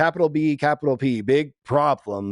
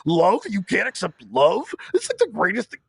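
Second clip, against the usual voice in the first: higher, incredulous mock voice; incredulous friend; 7 semitones higher than usual. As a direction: act baffled that anyone can't accept love. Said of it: "He's like".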